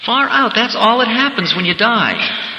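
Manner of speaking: light up at a happy discovery; amazed wonder. Retold or imitated. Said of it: "you begin to see".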